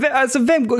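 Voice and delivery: ordinary talk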